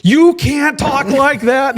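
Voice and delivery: slow, high, laughing scold; chief's scolding through laughter. Retold or imitated. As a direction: scold while cracking up. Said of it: "He said".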